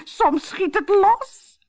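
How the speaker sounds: slow, very high mock-bride squeal; giggly. A narrator's reading. Harlekijn in disguise alarms the captain, giggly. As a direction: draw it out in a silly squeak. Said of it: "giechelde Harlekijn".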